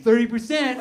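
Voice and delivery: high, very loud jeer; mocking shout. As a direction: yell it to ridicule a coworker's answer. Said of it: "they make fun of me".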